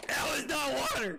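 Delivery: loud, high protest through laughter; laughing scream. Real, not acted. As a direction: yell the shock out while laughing. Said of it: "That scream".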